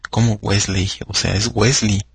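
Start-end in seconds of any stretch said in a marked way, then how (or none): 0.4-0.9 Wesley said with an "ey" ending; Wesley with the E of -ley pronounced; wrong pronunciation. Shown as a demonstration. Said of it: "Se oye hasta feo". 1.5-2.0 Wesley ending in "lee"; Wesley with the E of -ley swallowed; correct pronunciation. Shown as a demonstration. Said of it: "así se pronuncia".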